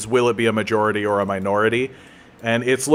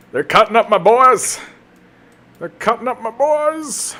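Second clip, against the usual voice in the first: much higher, wailing protest; anguished cry; 11 semitones higher, 4 dB louder than usual. Real, not acted. Gamer watching archers kill his units, high wailing cry. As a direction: wail as your troops get cut down.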